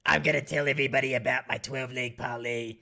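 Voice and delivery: gravelly smoker rasp; rough throaty announcer voice; raspy smoker voice. Acted. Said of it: "make me cough if I talk like this".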